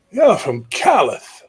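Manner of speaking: put-on high, loud old king's voice. Old king summoning the young high king closer. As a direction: croak it out like an ancient ruler.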